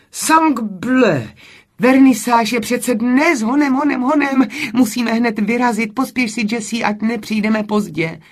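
high, loud, agitated outburst; frantic urging. A narrator's reading. Camille hurries her friend to the vernissage, frantic and loud.

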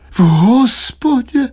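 high, drawn-out moaned question; moaning. A narrator's reading. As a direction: moan it back in dismay. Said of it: "zasténala paní Štědrá".

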